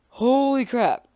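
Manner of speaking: gasp, then high, loud, slow; stunned by what appears; a real gasp of alarm; gasping shock. Real, not acted. Sees a big cat.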